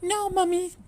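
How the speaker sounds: very high tiny voice; a frightened baby duck; squeaky duckling protest; scared little duckling. A narrator's reading. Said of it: "they cried".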